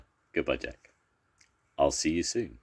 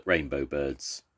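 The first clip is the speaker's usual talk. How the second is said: usual speaking voice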